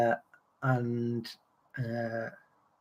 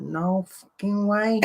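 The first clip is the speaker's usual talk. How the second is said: slow, higher mock astonishment; retold disbelief; 6 semitones higher, slower than usual. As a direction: draw it out as if stunned, imitating someone. Acts stranger's shocked disbelief.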